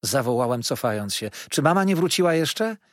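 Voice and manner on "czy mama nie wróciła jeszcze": higher, louder worried plea; anxious boy asking for his mother; anxious child's question. Acted. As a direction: ask nervously for mama.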